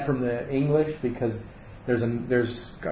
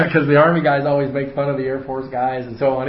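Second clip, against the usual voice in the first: laughing; 3 semitones higher, 7 dB louder than usual. Real, not acted. Joking about Army and Air Force.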